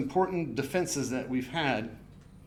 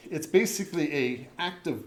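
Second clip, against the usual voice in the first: ordinary talk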